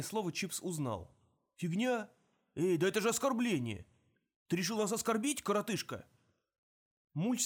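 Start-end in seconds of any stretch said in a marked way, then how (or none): 1.6-6.1 high, indignant shout; offended outburst. Acted. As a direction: take real offence. Chips offended.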